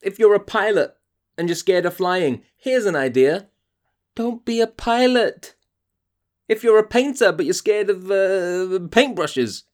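voice going high. Real, not acted. Podcaster ranting at actors who fear their job, high-pitched. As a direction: climb up in frustrated disbelief. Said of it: "My voice is going so high".

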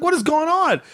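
high-pitched mock bewilderment; bewildered exclamation. Driver asking his screaming family, bewildered. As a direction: demand an explanation, voice jumping up.

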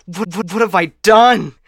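sudden panic at your own deed; horrified outburst. Acted. AI realizing it doomed the humans, horrified cry.

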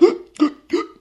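sudden high gasp for air; sharp gasp. Shown as a demonstration. Showing the listener how he gulped in water, sharp gasp. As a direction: gulp in air like you're drowning. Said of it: "You start kinda".

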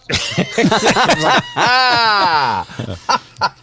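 high loud burst of laughter; big laugh. Real, not acted. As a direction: laugh out hard. Laugh at own joke.

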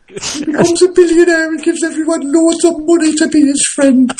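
very high, squeaky little gingerbread man voice; high Northeast gingerbread man. Retold or imitated. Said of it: "the high-pitched nature of, uh, of [name]'s impression".

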